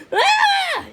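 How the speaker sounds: sudden very high shriek. A narrator's reading. Frog above the gators, high shriek. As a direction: shriek as the jaws snap. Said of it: "he shrieked".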